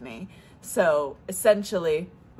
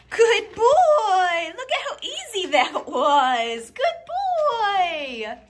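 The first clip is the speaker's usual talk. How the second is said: very high, bright praise with a laugh; high dog-praise voice; 12 semitones higher, 5 dB louder than usual. Real, not acted. Owner praising Lambo for solving the puzzle, high and happy.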